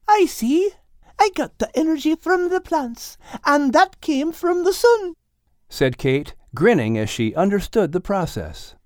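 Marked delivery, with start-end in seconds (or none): 0.1-5.1 put-on young girl voice. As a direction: ask it like a puzzled girl. Kate's lines.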